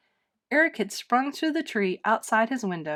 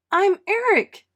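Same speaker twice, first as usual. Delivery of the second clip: much higher, loud joyful cry; excited cry; 10 semitones higher, 7 dB louder than usual. Acted. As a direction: burst out with the reveal. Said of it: "cried Eric".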